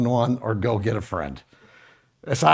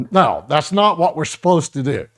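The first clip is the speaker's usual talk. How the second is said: normal talking voice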